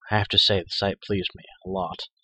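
plain reading voice

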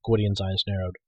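ordinary delivery

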